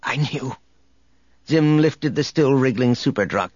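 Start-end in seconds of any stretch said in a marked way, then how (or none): 0.0-0.6 whispering. A narrator's reading. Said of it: "Lygeus whispered".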